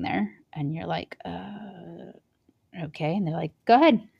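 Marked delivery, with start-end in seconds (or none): 1.2-3.1 soft, timid little uh, okay; hesitant and unsure. Retold or imitated. New worker unsure in front of the boss, timid and soft. 3.7-4.1 higher, louder brisk command; curt boss order. Retold or imitated. Boss telling the new worker to start, curt and loud.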